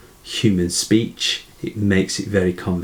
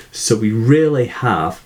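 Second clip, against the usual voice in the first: normal talking voice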